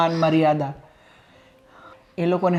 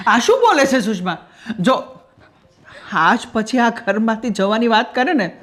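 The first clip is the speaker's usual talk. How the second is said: higher, louder, pleading insistence; husband begging his wife not to leave, louder and urgent; alarmed protest; 5 semitones higher, 4 dB louder than usual. Acted. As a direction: swear her to stay, shaken and loud. Husband forbids wife leaving home.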